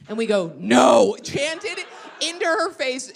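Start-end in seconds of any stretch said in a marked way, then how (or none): 0.6-1.2 loud blunt yell. Retold or imitated. Comics answer a girl asking if they're famous, yelling. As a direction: yell it right in someone's face. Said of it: "yelled at her".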